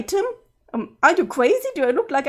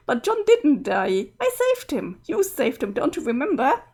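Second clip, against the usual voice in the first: ordinary talk, nothing marked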